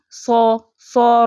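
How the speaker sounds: slow drawn-out so with an o sound; سو، سو recited slowly, o vowel held; recitation demo. Shown as a demonstration. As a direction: stretch سو slowly on a full o. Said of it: "سو میں پیچھے کس کی sound آ رہی ہے".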